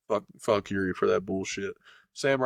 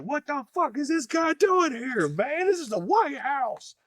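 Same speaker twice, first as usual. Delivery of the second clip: very high-pitched, slow mock outrage; appalled politicians; slower than usual. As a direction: squeal it slowly as a scandalised politician. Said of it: "politicians are gonna look at Trump like".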